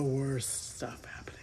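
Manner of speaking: hushed, slow whisper; whispered slowly, as if sharing something shameful. Real, not acted. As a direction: lean in and confide the dark secret. Confiding dark revelation.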